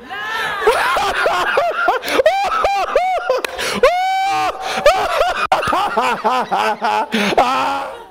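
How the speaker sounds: big shrieking laugh at the enemy. Real, not acted.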